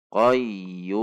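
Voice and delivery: "Qayyum" with the "yuu" sustained very long before m; long waqaf stop. Shown as a demonstration. Teacher shows stopping on the word, long held ending. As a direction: stretch the last vowel of "Qayyum" in slow recitation. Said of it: "maka dibaca panjang".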